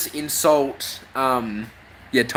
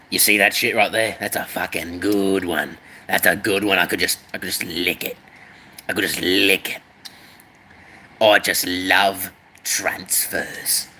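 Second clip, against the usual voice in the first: lower adoring voice; 8 semitones lower than usual. Acted.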